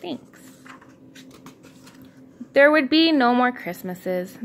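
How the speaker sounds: ordinary delivery, nothing marked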